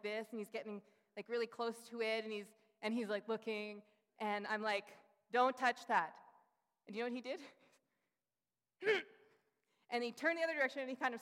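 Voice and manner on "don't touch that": loud, firm "don't touch that"; mother warning her son, loud; sharp warning. Retold or imitated. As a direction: stop the child in his tracks. Mother warns son near dog poo.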